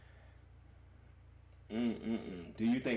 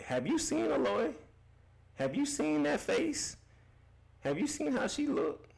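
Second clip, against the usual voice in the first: incredulous. Real, not acted. Mocking the chatter's choice.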